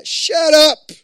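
sudden high, loud shout; shouted rebuke. Acted. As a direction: yell it to silence the enemy. Rebuking the enemy.

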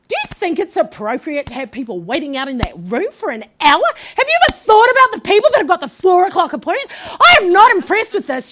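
giving attitude. Retold or imitated. Patient scolding the doctor for the wait, full attitude. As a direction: tell him off with mounting sass. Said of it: "giving this guy attitude".

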